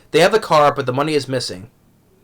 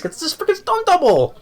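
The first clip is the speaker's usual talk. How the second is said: exasperated outburst; 13 semitones higher than usual. Real, not acted. Ranting vlogger's exasperated peak.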